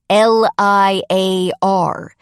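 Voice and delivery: slow, loud, emphatic repetition; deliberate, over-clear and forceful; spelling it out. A narrator's reading. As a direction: say it slowly for someone who misheard. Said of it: "He spells out the word".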